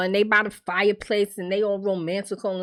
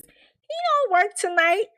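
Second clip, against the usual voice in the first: high-pitched, slow mock of her blunt line; high, slow mocking repeat; 12 semitones higher, slower than usual. Recapping the show, mocking Shanita for telling his business, high and slow. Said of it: "She just told all that man's business".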